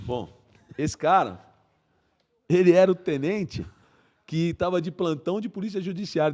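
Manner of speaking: usual speaking voice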